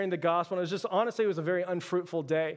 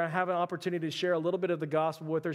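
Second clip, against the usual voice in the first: ordinary talk, nothing marked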